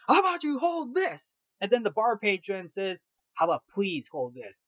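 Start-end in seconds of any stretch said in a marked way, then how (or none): 0.1-1.3 much higher, strained mock snarl. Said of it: "Will was like". 3.3-4.6 lower, steady, restrained reply; calm and stern. Retold or imitated. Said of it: "calm but sternful manner".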